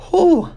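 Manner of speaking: high, loud startled whoosh of breath; high whew. Real, not acted. Vlogger startled by own selfie, loud high whew. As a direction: blow out a shocked whew.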